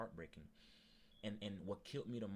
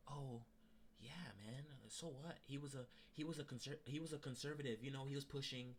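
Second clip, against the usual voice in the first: higher, dismissive coworker voice, shrugging it off; mocking coworker; 7 semitones higher than usual. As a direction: brush it off like the careless coworker. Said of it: "Then he goes like".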